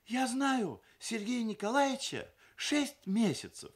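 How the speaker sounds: high-pitched recital on a full breath; bride's formal testimonial. A narrator's reading. Люда testifying for her fiancé, reciting formally. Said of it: "набрав воздуху, начала".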